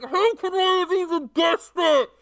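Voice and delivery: high loud anguished shout; desperate yell. Acted. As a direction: cry out being swallowed. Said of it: "Robert Pattinson is like".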